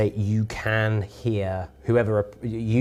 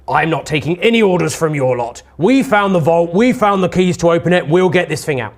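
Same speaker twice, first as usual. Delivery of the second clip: defiant gruff man, pitched up and louder; gruff and weary; 5 semitones higher, 7 dB louder than usual. Acted. Lorik refuses orders from the lady, gruff and defiant. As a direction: push back loudly as a gruff underling. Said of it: "is gruff and weary".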